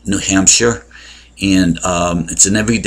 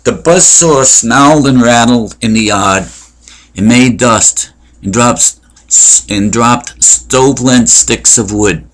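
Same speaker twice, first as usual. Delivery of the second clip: raised, carrying voice for the verse; poem reading; 3 semitones higher, 4 dB louder than usual. Acted. Reads Frost's poem Out Out.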